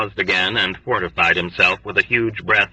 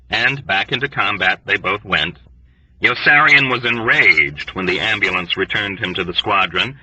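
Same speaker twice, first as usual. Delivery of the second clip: ordinary delivery, nothing marked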